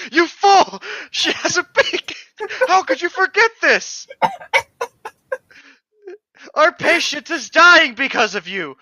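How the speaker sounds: high, loud hammed-up scolding; mock outrage. Reading the book's doctor scolding, hammed-up high outrage. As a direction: shriek at her like a furious doctor.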